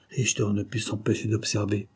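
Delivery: ordinary delivery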